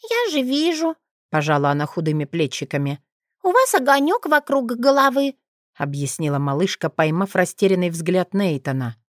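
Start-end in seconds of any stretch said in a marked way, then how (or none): 0.0-0.9 put-on little girl voice. 3.4-5.3 put-on little girl voice.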